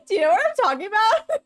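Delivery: cracking up mid-question; high giggly laughter; laughing. Real, not acted. Laughing hard at vocal fry joke.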